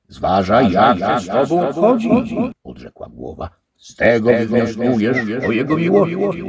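wyżej i głośno, obco brzmiący; głos głowy. A narrator's reading. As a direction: odpowiada zagadkowo. The head answers. (głowa odpowiada damie)

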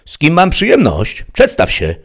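high, loud demand; calling out. A narrator's reading. Sheriff demands the stranger's name, calling out. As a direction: call out for his name.

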